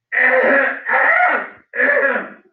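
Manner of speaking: loud, high, hammed-up throat clearing; exaggerated throat clearing. Sister protesting smoke at men nearby, mock loud throat clearing. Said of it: "a little bit more theater".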